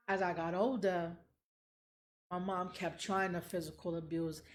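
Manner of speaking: normal talking voice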